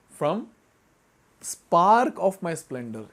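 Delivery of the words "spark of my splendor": slow, drawn out, weight on spark; stressed spark of my splendor. Shown as a demonstration. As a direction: lean hard on "spark" and stretch every word. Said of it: "He's not even saying from my splendor".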